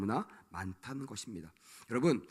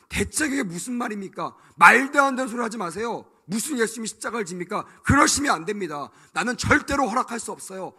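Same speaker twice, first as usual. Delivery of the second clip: loud, heated objection to the master; indignant protest; 4 semitones higher, 8 dB louder than usual. Retold or imitated. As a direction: refuse him outright, indignant. Peter rebuking Jesus, acted.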